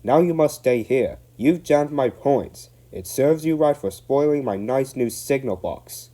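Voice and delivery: angry signalman. Acted. Signalman scolding Donald, angry. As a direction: tell the engine off crossly. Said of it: "he stormed".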